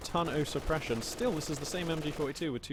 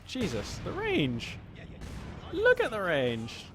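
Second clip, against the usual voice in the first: amazed outcry; 5 semitones higher, much slower than usual. Real, not acted. Caster amazed at tank range.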